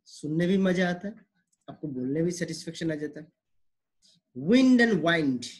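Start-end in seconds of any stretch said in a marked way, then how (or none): 4.4-5.5 very slow, wind with short i then wind with long ai; contrast wind and wind. Shown as a demonstration. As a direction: drag out both words to set the vowels apart. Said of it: "So wind is not wind".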